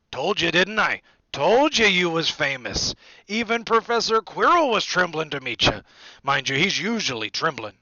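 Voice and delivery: loud, delighted big-man voice; hearty Hagrid. A narrator's reading. Hagrid proud of famous Harry, loud and hearty. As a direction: boast it in a booming voice.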